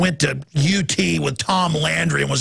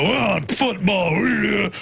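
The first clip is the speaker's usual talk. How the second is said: slow, dopey macho voice; mock strutting jock; slower than usual. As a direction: play a preening football bro. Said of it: "strut around and go".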